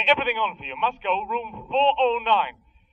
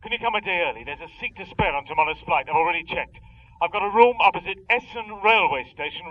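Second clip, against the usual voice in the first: usual speaking voice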